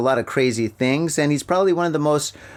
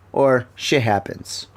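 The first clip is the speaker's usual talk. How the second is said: ordinary talk, nothing marked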